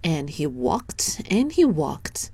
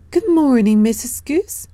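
put-on higher, louder little boy hello; eager child greeting; 8 semitones higher, 5 dB louder than usual. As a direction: call out a cheerful boyish hello. Danny greets the goose.

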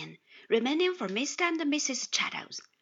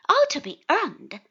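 higher, louder cheerful exclamation; upbeat, raised cheery remark; 5 semitones higher, 7 dB louder than usual. A narrator's reading. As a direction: call it out cheerfully.

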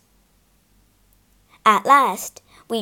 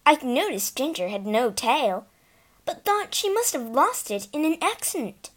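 plain reading voice

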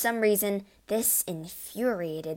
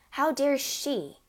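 indignant; 6 semitones higher, slower than usual. A narrator's reading. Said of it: "he seemed to be saying".

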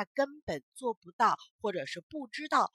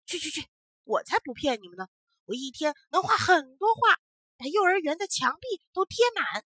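fast, loud, annoyed boast; 6 semitones higher, 8 dB louder than usual. Acted. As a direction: brush them off, irritated. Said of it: "不高兴地说".

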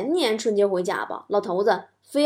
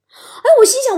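gushing; 13 semitones higher, 8 dB louder than usual. Retold or imitated. Acts out being touched.

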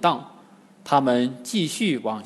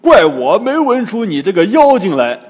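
loud, higher-pitched indignant Bajie; scornful outburst; 4 semitones higher, 9 dB louder than usual. A narrator's reading. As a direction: blurt it out in loud disgust. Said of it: "八戒呸了一声". (八戒大声怒斥)

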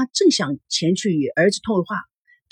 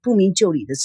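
ordinary delivery

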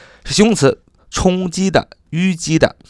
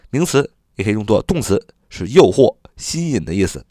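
normal talking voice

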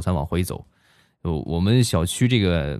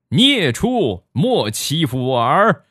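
higher, louder, slow, grand battle cry; hammed-up warrior's shout, slow and high; mock heroic shout; 7 semitones higher, 3 dB louder, much slower than usual. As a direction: roar at the beast like a kung fu master. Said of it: "只听见我爹一声呐喊".

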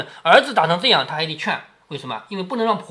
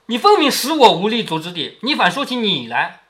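normal talking voice

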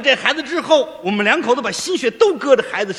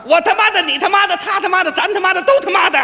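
imitating the son's cursing; 6 semitones higher, faster than usual. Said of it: "那怎么搁嘴边上啊".

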